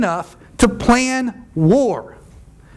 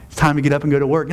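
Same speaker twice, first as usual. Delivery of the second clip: lower, fast bossy mom; 7 semitones lower, much faster than usual. Retold or imitated. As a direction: rattle it off like a nagging mom. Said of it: "like a mom and says".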